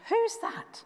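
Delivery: put-on high-pitched startled boy's question. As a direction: jump at a noise in the dark. Samuel startled by voice at night.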